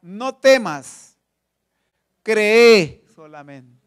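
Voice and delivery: very slow, firm, reassuring words; calm Jairo slowly and deliberately; Jesus reassuring. Acted. Jesus to the grieving father, slow reassurance. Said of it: "le dice al principal".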